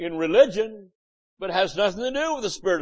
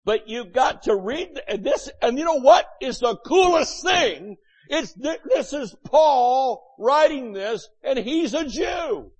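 excited preaching; 6 semitones higher than usual. Real, not acted. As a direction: build it up with excitement.

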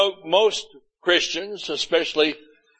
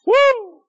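high, loud "woo"; excited whoop; 7 dB louder than usual. Real, not acted. Preacher punctuating the verse for the congregation, high excited whoop.

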